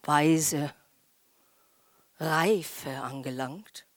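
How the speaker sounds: lightly voiced impressed listener; mock admiration. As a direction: murmur it like smug false wisdom. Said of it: "jeder sitzt da und sagt".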